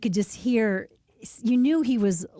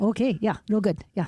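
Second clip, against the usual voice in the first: put-on Minnesota accent. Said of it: "you betcha".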